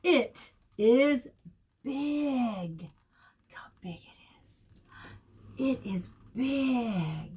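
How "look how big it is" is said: softer hushed whisper of wonder. Acted. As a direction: tell them like a secret discovery. Reading book to children.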